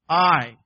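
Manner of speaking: stressed I, thundering; emphatic I. Shown as a demonstration. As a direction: hit the word I hard, as God's own claim. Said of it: "emphatic in the Hebrew".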